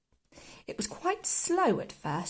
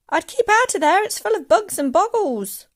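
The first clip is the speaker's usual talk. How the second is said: put-on high, loud boy; raised, anxious child's voice; called warning; 8 semitones higher, 9 dB louder than usual. A narrator's reading. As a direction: warn her urgently from below. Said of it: "George called out".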